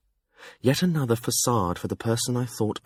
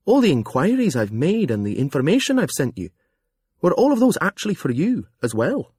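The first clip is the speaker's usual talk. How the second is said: louder, higher, indignant questions; indignant woman's voice; 6 semitones higher, 5 dB louder than usual. Acted. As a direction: press him with growing indignation.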